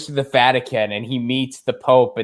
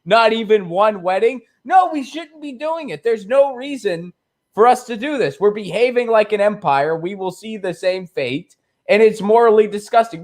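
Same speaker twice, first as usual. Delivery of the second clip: higher voice, switching between centrist and purist; mock back-and-forth; 7 semitones higher than usual. Argument between purist and centrist, higher mocking voices. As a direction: play both sides of the argument. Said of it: "It's just like".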